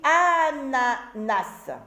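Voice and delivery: "ananas" very slow, first A held long; syllable by syllable. Shown as a demonstration. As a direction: stretch "ananas" out as a, na, nas. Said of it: "je commence par la première syllabe".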